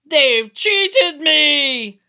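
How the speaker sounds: very loud, very slow drawn-out howl. A narrator's reading. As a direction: wail it out in outrage. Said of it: "howled Tim".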